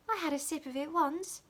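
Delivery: put-on high girlish reply; eager little confession; little maid telling Dick about beer; small servant. A narrator's reading. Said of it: "cried the small servant".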